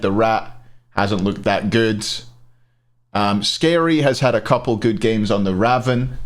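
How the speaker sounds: ordinary delivery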